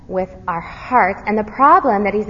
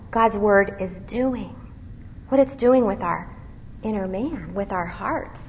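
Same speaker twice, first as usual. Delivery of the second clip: ordinary delivery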